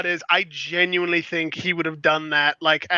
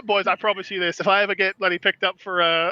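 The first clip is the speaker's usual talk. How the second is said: usual speaking voice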